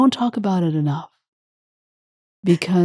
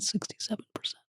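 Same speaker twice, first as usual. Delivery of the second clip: whispered, overcome by the figure; choked whisper; 3 semitones higher, 17 dB quieter than usual. Real, not acted. Host reading hate crime data, whispering with emotion. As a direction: barely voice it, too moved to speak up. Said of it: "I am so emotional".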